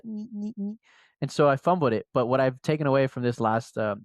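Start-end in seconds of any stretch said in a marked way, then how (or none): 0.0-0.8 small, high-pitched attention-seeking squeak; high, quiet mimicked whine; imitated pleading squeal. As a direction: squeak softly like someone begging to be picked. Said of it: "I kept doing the".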